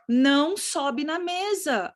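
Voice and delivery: drawn-out parental prohibition on "não"; parent's warning. Shown as a demonstration. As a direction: stretch "não sobe" out as a firm ban. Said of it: "Quando você fala assim".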